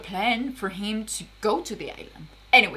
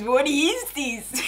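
drawn out very slowly, each word stretched; slow disbelief; 2 semitones higher, much slower than usual. Real, not acted. Repeated disbelief.

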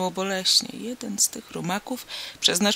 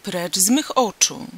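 louder, commanding banishment; shouted dismissal; 5 dB louder than usual. Acted. Princess driving the stranger away, louder command. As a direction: stamp and order him out. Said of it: "tupnęła nogą o ziemię i zawołała".

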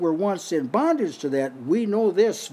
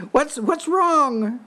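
higher, slow concerned angel; angel's voice; 8 semitones higher, much slower than usual. Acted. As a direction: ask it slowly, high, as the angel. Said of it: "this is an angel talking".

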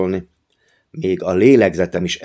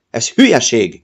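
mild shout, a bit higher and louder; 4 semitones higher, 4 dB louder than usual. A narrator's reading. Uncle berates the boy's drawing, mild shout. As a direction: snap it out at the boy. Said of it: "kiabálta a bácsi".

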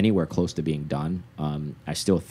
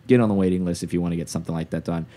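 ordinary talk, nothing marked

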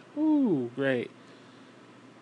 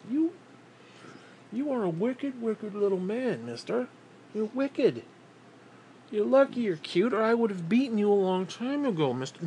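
higher, teasing scold; mock scolding; 7 semitones higher than usual. Real, not acted. Owner teasing his cute dog, higher mock scold. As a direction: playfully tell the dog off.

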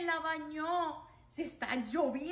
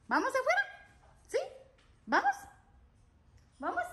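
high pet talk; 10 semitones higher, much faster than usual. Real, not acted. Owner coaxing her dog to go outside, high pet talk. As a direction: ask the dog sweetly up high.

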